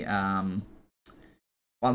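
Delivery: ordinary talk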